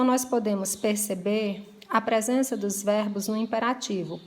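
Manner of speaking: ordinary talk, nothing marked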